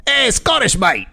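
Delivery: put-on high-pitched, failed Scottish accent; bad Scottish accent. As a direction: badly fake a Scottish accent. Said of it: "That's not Scottish".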